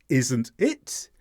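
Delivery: "it" stressed and rising; unsure tag question, lifting "it". Shown as a demonstration. Teacher repeats the tag to show an unsure question, "it" rising. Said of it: "It being the stressed word".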